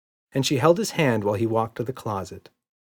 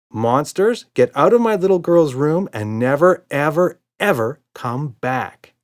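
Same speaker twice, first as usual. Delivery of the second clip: loud stern warning, slow at the end; a hint of a stern father; very serious; 6 semitones higher, 7 dB louder than usual. A narrator's reading. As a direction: command the monsters firmly. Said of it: "in a very, very serious voice".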